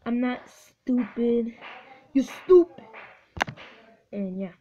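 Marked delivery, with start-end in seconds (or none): 2.1-2.8 loud, high outburst; sudden indignant shout; shouted insult. Real, not acted. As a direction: yell it suddenly, pitch jumping up. Outburst during play.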